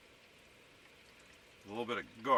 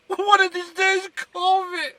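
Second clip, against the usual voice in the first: very high, much louder falsetto squawk demanding a call; nagging caller; 11 dB louder than usual. Acted. Pretending to be someone ignored by family, shrieking in falsetto. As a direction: shriek it like an offended relative who never gets phoned.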